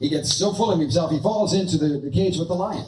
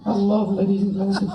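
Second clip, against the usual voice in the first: ventriloquist dummy voice; 3 semitones higher than usual. Acted. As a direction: pitch it up like a puppet.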